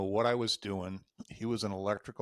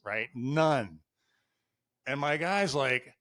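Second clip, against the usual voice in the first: usual speaking voice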